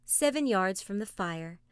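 ordinary delivery

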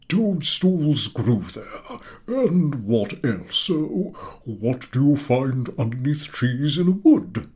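very slow, high teacher's prompt; coaxing professor. A narrator's reading. Professor prompting the class, very slow. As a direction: drag it out.

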